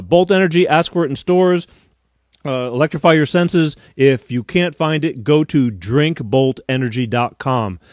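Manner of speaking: emphatic radio-spot read; sponsor ad read. Acted. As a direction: pitch the product loud and clear.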